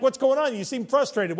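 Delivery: high, loud mock concern; nosy coworker. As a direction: act out the prying coworker. Coworkers asking about frustration.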